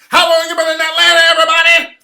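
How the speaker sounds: high, very loud shouted hype-man voice; screaming crispy character voice; crispy voice yell. Retold or imitated. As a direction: bellow it like a hyped-up character who won't quit. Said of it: "yelling in the crispy voice".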